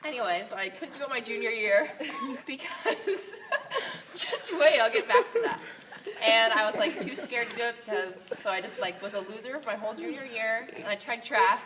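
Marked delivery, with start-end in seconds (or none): 0.0-5.6 laughing. Real, not acted. Laughs through embarrassing story.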